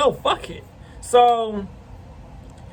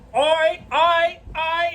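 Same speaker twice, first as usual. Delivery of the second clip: retold alarmed backing off; 8 semitones higher than usual. Neighbor backing away from gun.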